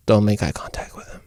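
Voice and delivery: soft, low cautionary aside; hushed warning. Acted. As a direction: warn someone under your breath. Aside about a scary big guy.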